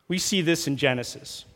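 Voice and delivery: ordinary delivery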